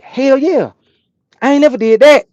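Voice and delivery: high, loud eager yes; excited. Retold or imitated. Trucker accepts the chicken load, excited. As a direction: jump at the chance, thrilled. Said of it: "I said".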